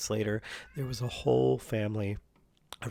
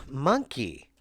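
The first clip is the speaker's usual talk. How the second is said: normal talking voice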